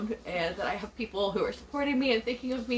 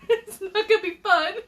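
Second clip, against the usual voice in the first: laughing, higher and louder; real laughter breaking through; 12 semitones higher, 3 dB louder than usual. Real, not acted. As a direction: giggle through a mock complaint. Laughing about getting a shot.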